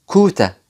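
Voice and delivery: كوتا said KOH-tah, no r; British quota. Shown as a demonstration. Teacher models British quarter for students. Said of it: "نطقها ايه".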